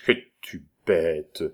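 lent et tendre, comme on implore un pardon; doucement, implorant. A narrator's reading. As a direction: murmure presque suppliant, en prenant son temps. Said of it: "fit Joujou doucement, et du ton dont on implore un pardon". (Joulou implore le pardon de Marguerite, tout doux)

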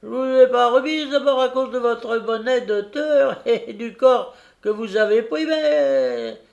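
put-on high, cracked old coach voice; old fairy voice. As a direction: speak as a creaky old stagecoach. Old diligence speaks as character.

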